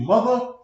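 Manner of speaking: "mother" stressed, pitch lifted; emphasis on MOTHER. Shown as a demonstration. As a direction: lean hard on the word "mother". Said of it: "you have to put emphasis on".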